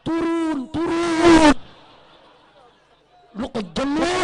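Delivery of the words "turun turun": drawn-out crowd yell, building louder; imitated heckling. Orator imitates crowd heckling the speaking President, building yell. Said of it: "Presiden datang ngomong udah teriak-teriakin".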